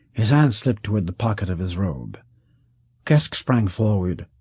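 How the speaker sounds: ordinary talk, nothing marked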